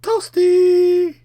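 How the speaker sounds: high, loud joke callout; announcer shout. Pretend. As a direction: yell it like a game announcer. Joke about the incineration.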